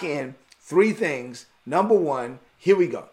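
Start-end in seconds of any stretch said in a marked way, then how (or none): none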